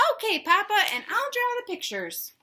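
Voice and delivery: very high chicken voice. Acted. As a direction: chirp it back eagerly like a chicken. Chicken agrees.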